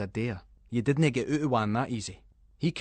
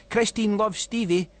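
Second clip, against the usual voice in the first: sing-song graffiti read; 10 semitones higher, 4 dB louder than usual. Acted. Reading graffiti in a voice.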